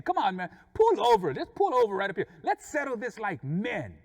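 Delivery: high, loud call to pull over; fighting challenge. Retold or imitated. Driver ready to fight the other man, fighting challenge. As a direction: urge him loudly to have it out. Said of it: "to fight this dude".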